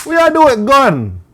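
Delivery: high, loud, slow mock scolding; mother scolding. Mom confronting her kid, drawn-out high scolding. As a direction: draw it out loud and high in accusation.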